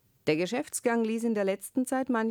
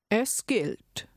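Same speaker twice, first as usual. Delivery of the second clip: higher-pitched, decisive call; 5 semitones higher than usual. A narrator's reading. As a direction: call out a mild acceptance of the bet, pitched up. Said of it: "rief der Wirt". (mit gehobener Stimme gerufen)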